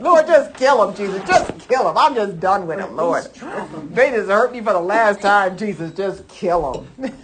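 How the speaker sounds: loud, fed-up prayer begging God to smite them; mock angry prayer. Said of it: "How about how we have said that?".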